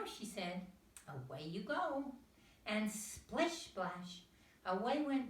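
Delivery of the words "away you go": bright urging mother duck; higher mother duck voice. A narrator's reading. Missus Duck urging her ducklings to swim, mother duck voice. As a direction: send the ducklings off cheerfully.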